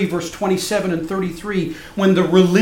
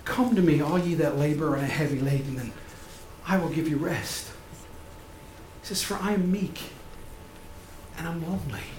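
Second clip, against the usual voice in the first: soft, tender invitation; soft-voiced, gentle comfort to the weary; gentle Jesus; 4 semitones lower, 10 dB quieter than usual. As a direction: offer rest quietly and tenderly. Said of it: "think of how different his words are".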